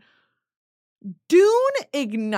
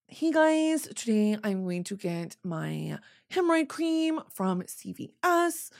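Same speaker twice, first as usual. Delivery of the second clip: mock influencer voice; 4 dB quieter than usual. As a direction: make fun of a vlogger's affected lilt. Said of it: "making fun of influencer voice".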